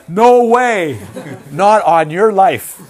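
loud, high shouted refusal; panicked student refusal. Acted. Student refusing a canoe trip with the teacher, shouted in horror. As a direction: shout it in comic horror, drawn out.

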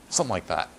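ordinary delivery

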